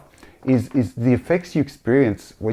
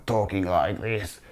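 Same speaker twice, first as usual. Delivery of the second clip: crusty voice; 2 semitones lower, 7 dB quieter, slower than usual. Retold or imitated. Man showing how the possessed lady talked, crusty voice. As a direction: imitate a crusty, possessed voice, kept soft. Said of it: "it was like a crusty voice now".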